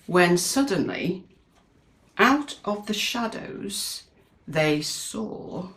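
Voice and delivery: storybook narration. A narrator's reading. Reading the picture book to a child, suspenseful narration. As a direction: read it like the suspense is mounting.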